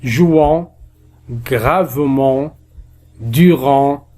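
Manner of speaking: "Jouant", "gravement", "durant" with long final nasal vowels; drawn-out nasal sound. Shown as a demonstration. Teacher reviewing the sound, "Jouant", "gravement", "durant" slow and held. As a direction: stretch each ending slowly. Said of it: "Alors le son en".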